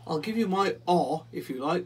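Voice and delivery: lightly voiced friendly dog; a hint of a woof. A narrator's reading. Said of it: "woofed the dog".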